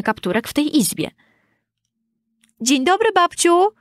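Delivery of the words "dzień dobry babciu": high, raised-voice greeting; loud, high child's hello. A narrator's reading. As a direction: call out to granny, pitched up. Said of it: "powiedział głośno".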